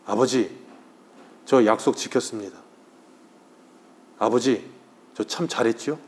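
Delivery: hushed, tearful words to a dead father; tearful son. Retold or imitated. Son speaking to his late father, soft and tearful. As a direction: quietly choke it out like an old man missing his father.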